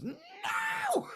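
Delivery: very high-pitched screamed refusal; child's tantrum scream. Acted. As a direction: shriek it like a boy refusing a bath. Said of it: "Just starts screaming".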